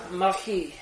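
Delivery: Machi said in a put-on Hebrew accent; mock Hebrew accent. Shown as a demonstration. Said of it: "as you would say in Hebrew".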